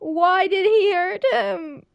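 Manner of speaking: high, tearful wail of grief, sighing first; crying out. Real, not acted. Streamer, grieving a game character who was hurt, tearful wail. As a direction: cry it out, heartbroken.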